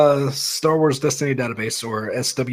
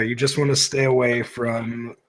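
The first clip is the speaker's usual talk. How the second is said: ordinary talk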